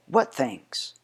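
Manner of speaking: ordinary delivery, nothing marked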